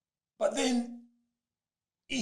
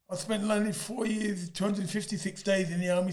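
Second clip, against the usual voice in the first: ordinary delivery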